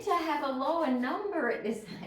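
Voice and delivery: incredulous protest. Retold or imitated. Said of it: "I was like".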